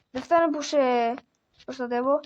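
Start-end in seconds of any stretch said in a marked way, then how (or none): none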